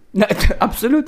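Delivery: high and loud laughing sarcasm; sarcastic laughing yes. Real, not acted. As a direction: laugh out the agreement.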